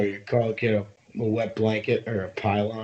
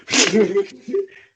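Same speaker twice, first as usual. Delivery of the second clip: high loud mocking cackle; mocking laugh; 11 semitones higher, 8 dB louder than usual. Imitating opponents laughing off the chirp, high jeering cackle. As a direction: laugh him off in a high jeer. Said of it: "and they'd be like".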